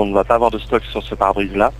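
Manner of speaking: ordinary talk, nothing marked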